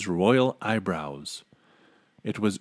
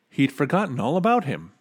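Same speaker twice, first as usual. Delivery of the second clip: plain reading voice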